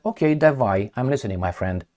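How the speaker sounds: low, quiet, unhurried Putin reply; calm mock Putin. Putin answering Trump's offer, quiet and calm. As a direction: answer like a cool, unbothered Putin.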